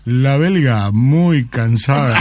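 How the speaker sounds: very slow verdict ending in a laugh; slow Japanese man, punchline. Acted. Japanese lover delivering the Belgian punchline, very slow. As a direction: drag it out, then crack up.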